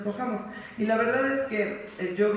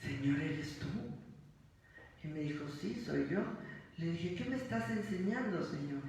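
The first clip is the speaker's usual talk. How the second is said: much softer, hushed and reverent; a quiet, awed exchange with the Lord; hushed awe; 9 dB quieter than usual. Retold or imitated. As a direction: speak to God in a hushed voice. Retold hushed talk with God.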